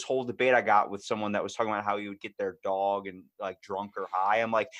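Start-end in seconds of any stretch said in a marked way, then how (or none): none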